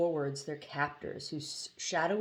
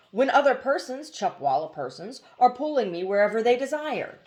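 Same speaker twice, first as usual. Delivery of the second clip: higher, louder bird voice in protest; lamenting hoopoe; 6 semitones higher, 6 dB louder than usual. A narrator's reading. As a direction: complain about being dragged wherever they like. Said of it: "the hoopoe lamented".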